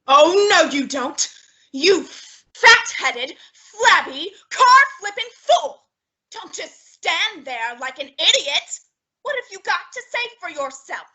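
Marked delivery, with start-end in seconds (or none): 0.1-5.8 loud, angry insults; furious tirade. Acted. Missus Niles berating Costello for the crash. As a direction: tear into him.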